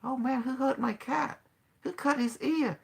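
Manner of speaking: high, puzzled, innocent asking; mock surprise. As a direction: play dumb, wide-eyed. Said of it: "I said".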